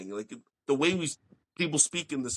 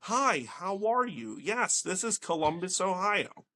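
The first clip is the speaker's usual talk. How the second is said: slow, higher-pitched mock Ohio accent; proper Ohio accent; 4 semitones higher, slower than usual. New Yorker imitating Ohio locals, slow proper Ohio accent. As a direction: imitate an overly proper Ohioan, drawn out. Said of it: "Yeah, very proper, right?".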